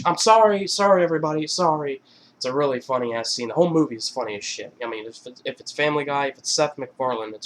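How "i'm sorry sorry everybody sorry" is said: raised, higher apologetic call; mock public apology. Embarrassed husband apologising to premiere guests, raised mock apology. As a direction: call out a hasty sorry to the crowd.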